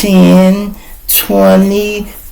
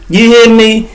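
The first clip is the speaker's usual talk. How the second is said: normal talking voice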